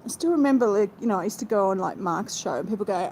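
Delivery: ordinary talk, nothing marked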